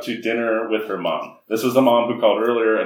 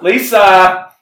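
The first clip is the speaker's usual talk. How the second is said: high, loud shrill call of the name; shrill mom call; 6 semitones higher, 7 dB louder than usual. Retold or imitated. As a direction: call out like a screechy horror mom. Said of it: "in this very shrill sort of a way".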